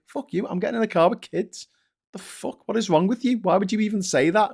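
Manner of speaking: mock outrage. Ranting retort to elder.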